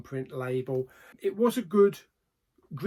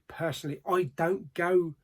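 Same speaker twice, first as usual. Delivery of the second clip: usual speaking voice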